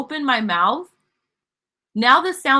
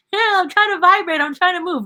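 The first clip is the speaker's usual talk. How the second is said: higher squeezed voice pleading "I'm trying"; voice of the struggling sound; 9 semitones higher than usual. Pretend. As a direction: play the cramped sound straining to vibrate. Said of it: "it's trying to, like".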